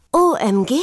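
loud high squeal of OMG; mock squeal; shrieking. A narrator's reading. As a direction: shriek it out like an excited kid. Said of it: "kreischte Pia".

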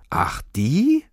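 breathy drawn-out eye-roll "Ach, die?"; whispered, dragged-out scorn; drawn-out scoff. Acted. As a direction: stretch it out, unimpressed and dismissive. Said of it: "fragte Frenzi gedehnt und verdrehte die Augen".

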